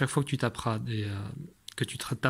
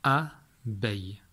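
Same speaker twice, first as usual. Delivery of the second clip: "Abeille" slowly split, A, long pause, beille; "Abeille" in two slow, distinct beats; syllable by syllable; 3 semitones lower, much slower than usual. Shown as a demonstration. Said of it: "Dans quelle syllabe".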